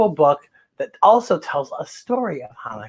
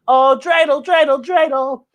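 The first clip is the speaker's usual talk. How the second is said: usual speaking voice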